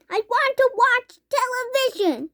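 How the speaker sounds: high squeaky tantrum; tiny boy's tantrum; tantrum squeak. A narrator's reading. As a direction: whine like a furious tiny boy. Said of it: "he squeaked".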